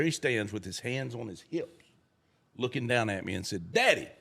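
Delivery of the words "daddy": put-on higher, louder cocky child; punchline in a sassy kid voice; cheeky little boy. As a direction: sass him like a bold four-year-old.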